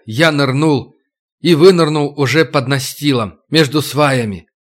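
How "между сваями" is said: ordinary delivery, nothing marked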